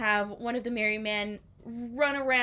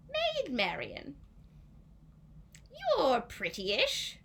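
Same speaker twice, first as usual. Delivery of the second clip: slow, higher, drawn-out flattery; lightly hammed-up smug compliment; smarmy king; 7 semitones higher, slower than usual. King sizing up Marian, slow smug flattery.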